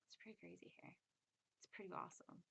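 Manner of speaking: hushed, voiceless aside; whisper. Acted. Teacher whispering an aside to her viewers. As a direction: lean in and whisper it like a secret. Sudden aside about the spirit realm.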